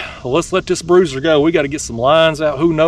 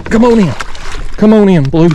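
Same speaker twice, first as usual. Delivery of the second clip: loud, raised coaxing call; urging on; 5 semitones higher, 4 dB louder than usual. Real, not acted. Angler to hooked fish on the line, urging it in loudly.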